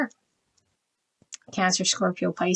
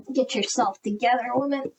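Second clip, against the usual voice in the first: self-scolding; 4 semitones higher than usual. Real, not acted. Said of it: "I'm a little discombobulated lately".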